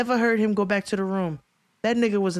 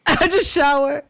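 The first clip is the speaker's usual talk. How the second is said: repeated through a laugh, higher and louder; 6 semitones higher, 4 dB louder than usual. Real, not acted. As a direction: laugh the words out as you say them again. Repeats line while laughing.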